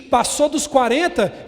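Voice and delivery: mock ad voice. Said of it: "uma propaganda que diz o seguinte".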